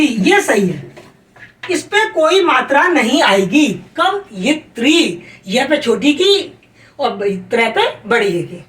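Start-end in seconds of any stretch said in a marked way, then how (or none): 4.0-5.2 "कवयित्री" said slowly, every syllable clear; careful slow pronunciation. Shown as a demonstration. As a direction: spell "कवयित्री" out with your voice, slowly. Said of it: "आप सही बोलेंगे तो सही लिखेंगे". ("कवयित्री" धीरे-धीरे, हर अक्षर साफ़)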